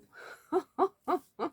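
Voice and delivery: high bursting laugh, caught off guard; laughing at your own English pronunciation; laugh at own accent. Real, not acted. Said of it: "Oh, l'accent".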